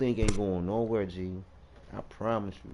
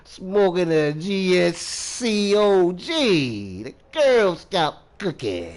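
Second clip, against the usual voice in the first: higher, very drawn-out hype; hammed-up boast; 4 semitones higher, 3 dB louder, slower than usual. Smoker showing off his strain, drawn-out playful boast. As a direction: crow each letter out slowly.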